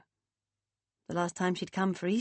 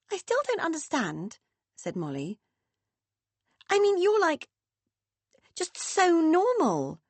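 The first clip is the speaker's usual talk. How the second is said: put-on high, wide-eyed young girl; young daughter, unsettled and higher; teenage daughter voice; 8 semitones higher than usual.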